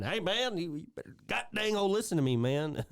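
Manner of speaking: mock Boomhauer. Imitating frustrated Boomhauer.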